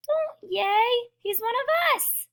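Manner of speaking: very high scolding cry; yelling. A narrator's reading. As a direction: protest in a small squeaky-high voice. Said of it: "yelled Pip".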